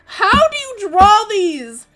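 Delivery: gushing over big mecha drawing; awed exclamation. Real, not acted. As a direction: marvel at the giant robots. Awed by giant mecha art.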